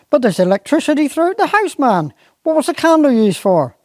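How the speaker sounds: high fast exasperation; baffled. Acted. Squire questions gamekeeper about the candle, baffled. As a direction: squire rattles off his objection.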